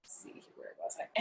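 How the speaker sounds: whispered to herself; hushed aside. Real, not acted. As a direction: whisper it, finding her place.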